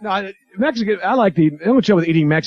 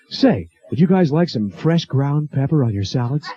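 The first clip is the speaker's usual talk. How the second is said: slow, put-on waiter's pitch; drawn-out salesy server voice; 4 semitones lower, slower than usual. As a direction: offer it like an overeager waiter. Waiter with giant pepper mill.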